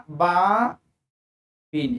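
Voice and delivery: बाबिन said slowly, with a clear n at the end; slow tanween demo. Shown as a demonstration. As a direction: model बाबिन slowly for the students. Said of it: "pronunciation इसका होगा".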